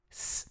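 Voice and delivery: a long, soft hissed "S"; isolated S sound. Shown as a demonstration. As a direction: hold "S" as pure hiss, no vowel. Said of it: "Tenta fazer". ("S" soprado e longo)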